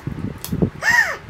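short high gasp of awe; sudden intake of breath; sharp gasp. Real, not acted. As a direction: gasp as the package opens. Opening the package, startled.

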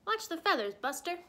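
a touch of ruffled protest; indignant yell. A narrator's reading. Penguin scolding the magician snowman, indignantly yelling. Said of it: "yelled the penguin".

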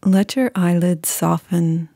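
calm, unhurried meditation voice with long pauses; guided meditation. Acted.

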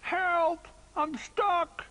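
high, loud stuck-and-helpless yell; little bear calling out in distress; cry for help. A narrator's reading. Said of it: "he cried with a mouthful of snow".

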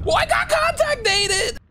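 high, loud, slow yell; shouting in disbelief; frustrated shout. Real, not acted. Angry outburst after dying.

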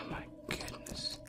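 slow breathy whisper. Real, not acted. Lost player whispering in disbelief. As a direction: sigh it out in a whisper.

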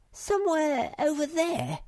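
very high small-creature voice; little creature Sniff. Acted. Sniff vaguely points out the cake, squeaky high.

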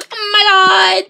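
loud hammed-up cry of alarm; mock startled cry. Player surprised by an enemy, crying out loud. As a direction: yelp as if ambushed. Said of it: "I'm like".

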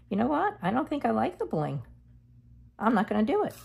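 sudden realization. Real, not acted. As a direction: catch yourself and decide. Sudden change of mind.

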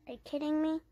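usual speaking voice

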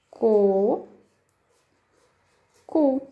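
"Co, cu" said slowly, hard k sound, each syllable apart. Shown as a demonstration. Teacher to first graders, demonstrating hard k syllables. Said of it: "a pronúncia é diferente".

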